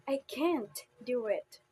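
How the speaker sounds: emphatic, falling stress on can't. Shown as a demonstration. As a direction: punch the word can't, then let it fall. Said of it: "it depends upon how you put emphasis".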